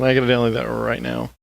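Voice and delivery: ordinary talk, nothing marked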